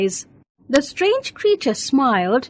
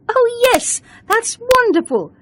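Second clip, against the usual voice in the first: excited; 10 semitones higher than usual. Acted. Beena cheered up by Karen.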